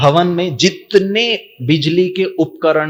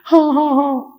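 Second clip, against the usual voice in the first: put-on high, little boy's yes; child agreeing; 13 semitones higher than usual. Boy giving in to his mother, high child voice.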